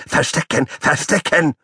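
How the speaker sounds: high-pitched excited little animal; shrill repeated plea; squeaky child call. Acted. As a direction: chant it like a pestering puppy.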